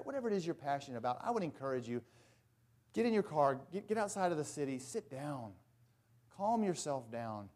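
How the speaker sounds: ordinary talk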